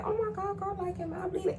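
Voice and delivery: high, soft "am I bleeding" worry; a light put-on girly panic; mock fretting, high and hushed; worried girly voice. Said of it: "I start to check my nose".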